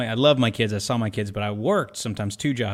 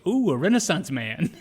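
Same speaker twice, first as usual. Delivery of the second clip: slow, laughing mock admiration; sarcastic tease; 6 semitones higher than usual. Date mocking the student driver, sarcastic and slow. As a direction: tease him through a laugh.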